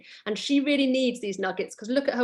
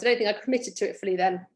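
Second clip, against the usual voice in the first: plain reading voice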